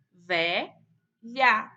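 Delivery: "velha" loud, in two pieces, ve-lha; syllable by syllable. Shown as a demonstration. Teacher answering how to separate it, "velha" in separated syllables. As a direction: clear pause in the middle of "velha". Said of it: "como que a gente separa".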